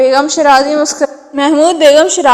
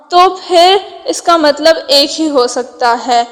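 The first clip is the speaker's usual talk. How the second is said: usual speaking voice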